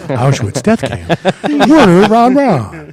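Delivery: hammed-up arena announcer drawing out the name; draft announcer. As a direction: call him out like a first-round draft pick. Mock sports draft announcement.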